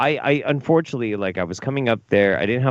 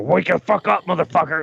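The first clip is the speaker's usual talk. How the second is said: mock yelling wake-up; 5 semitones higher than usual.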